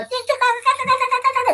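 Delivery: high squawking chicken cries; mock crying out. Kunta crying out while chasing the chicken, high and squawky. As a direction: squeal the word chicken over and over. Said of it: "and then he cried".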